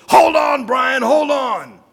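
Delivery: high, loud shouted encouragement; coach yelling. Retold or imitated. Wrestling coach shouting to his wrestler. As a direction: bellow it from the mat's edge. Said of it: "the coach yells".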